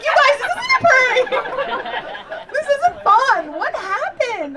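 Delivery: comic begging the cousins to stop, high panicked; panicked pleading. Retold or imitated. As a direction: plead in alarm, hammed-up terror.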